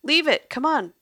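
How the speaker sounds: loud, high urgent command; urgent shout. Acted. Lily shouts at the maestro to leave the bags. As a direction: bark at him to drop everything. Fleeing surveillance, urging him.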